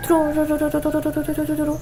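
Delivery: imitated running blender; steady blender hum. Shown as a demonstration. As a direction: run the trum without breaks. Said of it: "um som longo".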